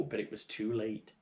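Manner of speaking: plain reading voice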